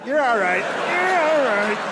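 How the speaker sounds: put-on sing-song baby comfort rising to a high slow coo; rising soothing coo. Comforting girlfriend like a toddler.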